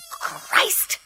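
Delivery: high, sharp cry of shock; detective stumbling onto the jars, shocked. Acted. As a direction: recoil at the horror in the cellar. Shocked by what he finds.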